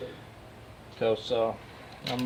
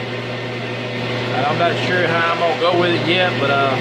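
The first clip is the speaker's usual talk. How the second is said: loud and higher, over machinery; raised voice over noise; 4 semitones higher, 4 dB louder than usual. Real, not acted. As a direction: project over a running lathe. Talking over the running lathe.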